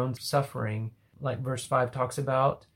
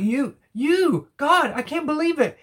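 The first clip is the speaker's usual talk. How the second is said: much higher, louder, breathless disbelief; stammering amazement; 11 semitones higher, 3 dB louder than usual. Acted. Psalmist amazed that God forgave him, stammering high. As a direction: stammer it out in awe. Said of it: "it's almost like stammering".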